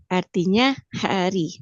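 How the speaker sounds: ordinary talk, nothing marked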